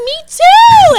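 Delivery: very high, loud squeal of recognition; excited shout. Retold or imitated. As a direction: burst out high and loud at the coincidence. Finds shared hometown, retold exclamation.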